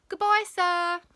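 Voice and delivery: high, loud singsong farewell; calling out. A narrator's reading. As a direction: call it cheekily across the playground. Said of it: "called out Rebel".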